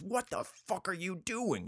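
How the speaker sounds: pissed-off creature voice, high and drawn out; screech-like indignation without the volume; high, slow, outraged little creature; Gollum. Acted. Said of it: "Gollum gets all fucking pissed off".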